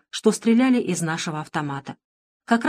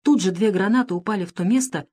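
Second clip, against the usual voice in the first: usual speaking voice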